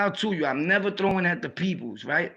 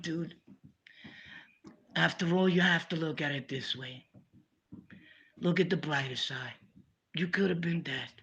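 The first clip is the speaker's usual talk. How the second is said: softer, gentle comfort for a crying cellmate; soft counselor voice; 6 dB quieter than usual. Retold or imitated. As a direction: talk him down softly like a counselor. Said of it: "and I'm like".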